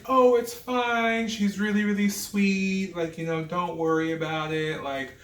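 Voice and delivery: high, gentle, breezy reassurance; sweet celebrity. Retold or imitated. Celebrity reassuring the apologizing staffer, high and breezy. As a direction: lightly voice a sweet, gracious star. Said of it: "And she's like".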